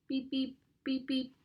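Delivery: small, even beeps in pairs; imitated beeping. A narrator's reading. Sound springs from the ground, imitated paired beeps. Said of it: "The sound sprang from the ground".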